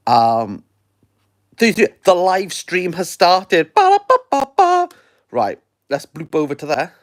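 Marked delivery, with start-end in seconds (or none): 3.7-4.9 high, loud vocal trumpet fanfare; mock fanfare. Host announcing the live stream has started, mock fanfare. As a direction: belt out a little announcing jingle.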